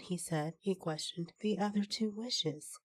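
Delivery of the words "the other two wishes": ordinary delivery, nothing marked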